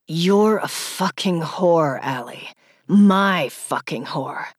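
low, slow and lightly menacing; slow taunting male voice. A narrator's reading. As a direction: drag out the taunt. Male lover's degrading taunt.

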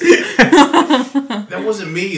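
slow, high denial through laughter; laughing denial. Real, not acted. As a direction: protest while cracking up. Denies it, laughing.

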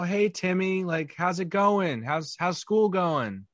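put-on high, slow, sugary voice to a child; overly cheery adult to kid. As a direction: talk up to the kid, a bit too bright. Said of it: "you're like".

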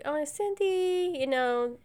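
mock-sweet horror movie line; imitating movie character. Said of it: "so she's like".

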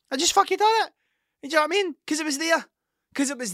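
squeaky, loud self-mocking rant; high-pitched exasperation. Real, not acted. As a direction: pitch up in disbelief at yourself. Self-mocking ice cream confession.

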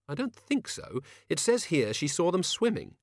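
high-pitched character voice; doubtful heroine. A narrator's reading. Susan correcting the O-God from the book, high and doubtful.

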